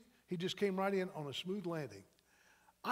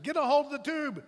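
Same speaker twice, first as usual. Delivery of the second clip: raised, higher urgent shout; urgent call; 5 semitones higher, 6 dB louder than usual. Retold or imitated. Rafter to a panicking man in the river, urgent call. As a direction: call it out to someone in trouble.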